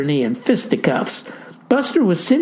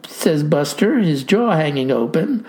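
usual speaking voice

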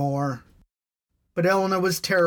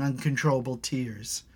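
ordinary delivery

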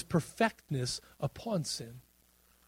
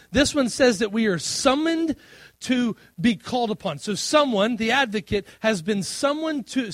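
preaching at full force; 6 semitones higher, 6 dB louder than usual. Real, not acted. Pastor explains the meaning of advocate, loud and high preaching. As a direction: hammer the definition home.

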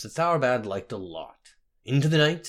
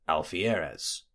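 usual speaking voice

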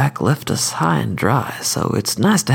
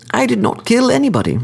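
higher, refined courteous assassin; cordial sophisticated killer; 12 semitones higher, faster than usual. Acted. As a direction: lecture the court, smooth and polite. Skidmire denies killing.

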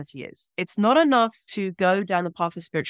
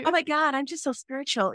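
higher, breathy-free valley-girl American accent, hammed up; American California accent, playful and high; mock California accent; 8 semitones higher than usual. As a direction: redo the LA accent, pitched well up, for a laugh. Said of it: "It was a California accent too".

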